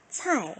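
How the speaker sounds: "차이" with a falling Mandarin fourth tone. Shown as a demonstration. Teacher models the syllable for students, "차이" in falling fourth tone. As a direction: drop the pitch sharply through "차이". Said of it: "제사 성이에요".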